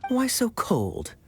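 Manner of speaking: high, slow, teasing pout; playfully wounded flirt; playful mock hurt. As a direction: tease her for being cold, drawn out. Kaeya teases the waitress.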